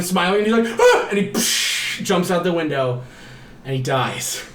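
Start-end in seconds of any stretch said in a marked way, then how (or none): 0.8-1.1 sudden short scream; mock terrified yelp. He sees naked smiling people, sudden mock-terrified yelp. Imitates boy before leaping out window. 1.3-2.0 hissed "psh" sound effect; imitated window crash. He jumps out the window, imitated crash.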